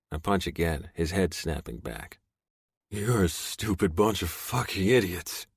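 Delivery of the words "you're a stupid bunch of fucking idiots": sneering captive. Acted. Beaten captive taunting his captors.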